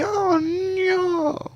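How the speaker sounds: very high squeaky Mario cry; bad Mario impression. Gamer mocking Mario as Ruto sinks, high squeaky cry. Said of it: "terrible impersonation of Mario".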